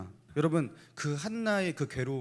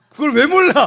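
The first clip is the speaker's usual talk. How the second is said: mock outrage; 13 semitones higher, 14 dB louder than usual.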